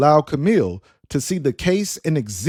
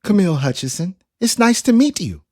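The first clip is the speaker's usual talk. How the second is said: calm feminine device voice; 5 semitones higher, faster than usual. A narrator's reading. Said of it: "with a calm, feminine voice".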